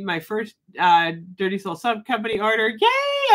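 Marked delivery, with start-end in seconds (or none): 2.7-3.3 a very high, gleeful cheer; excited yay. Real, not acted. Announcing her first soap order to viewers, happy squeal. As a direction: cheer like you just got great news. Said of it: "I'm so excited".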